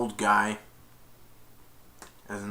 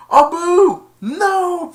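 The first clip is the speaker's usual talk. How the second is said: much higher, louder warning cry; high loud panicked no; alarmed shout; 12 dB louder, much slower than usual. Retold or imitated. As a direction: yell to stop the monkey in time. Aladdin stops Abu grabbing treasure.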